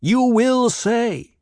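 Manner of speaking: Paul using the Voice on the crowd; commanding Voice. A narrator's reading. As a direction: bend them with every syllable. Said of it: "subtle intonations as he used the powers of voice".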